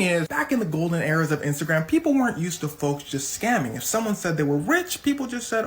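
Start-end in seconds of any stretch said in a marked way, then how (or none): none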